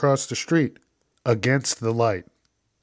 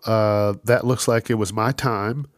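normal talking voice

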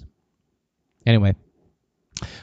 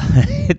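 burst of laughter. Real, not acted. Laughing at his own story.

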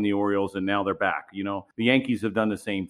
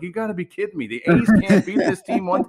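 half-laughing mock outrage, ending louder and higher; laughing disbelief; 9 semitones higher, 6 dB louder than usual. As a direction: retell the beer-in-hand frustration, cracking up at the end. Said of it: "You're like".

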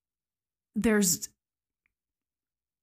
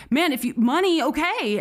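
higher and louder, tumbling over the words; nervous attendant caving to the hijacker; flustered agreement; 8 semitones higher, 4 dB louder than usual. Retold or imitated. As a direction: scramble to say yes to a gunman. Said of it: "and Francis says".

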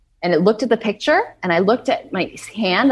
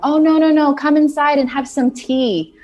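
high, loud, soothing voices of the two women; 9 semitones higher, 3 dB louder than usual. Retold or imitated. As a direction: coo it high and loud like calming a scared friend. Women reassuring the panicked narrator.

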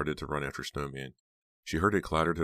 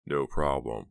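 plain reading voice

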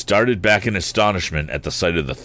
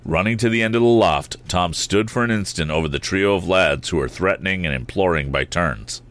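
ordinary delivery, nothing marked

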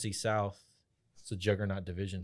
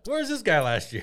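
high, loud exasperated question; mock incredulous; 10 semitones higher, 7 dB louder than usual. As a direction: voice a team's baffled complaint. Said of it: "I'm sure thinking".

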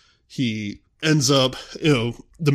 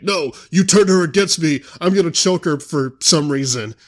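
higher, louder mock outrage; hammed-up whiny accusation; mock Anakin yell; 7 semitones higher, 6 dB louder than usual. Anakin yelling at Obi-Wan, mock outraged and higher. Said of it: "Obi-Wan comes out and he's like".

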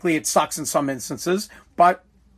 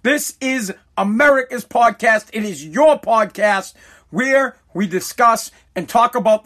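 higher, slow, emphatic show opener; podcast intro; 4 semitones higher than usual. Acted. Host welcomes listeners to America's podcast, announcer-style intro. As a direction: announce the show with drawn-out key words.

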